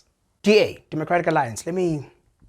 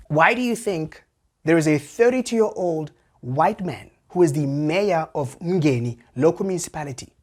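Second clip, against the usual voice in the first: usual speaking voice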